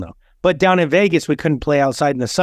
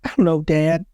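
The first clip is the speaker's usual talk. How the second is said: imitated young son; 4 semitones higher than usual. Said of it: "He'd be like".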